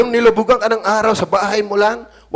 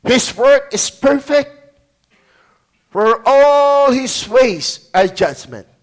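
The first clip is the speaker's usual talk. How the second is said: slow, solemn verse reading; 3 dB louder, slower than usual. Acted. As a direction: read each word weightily.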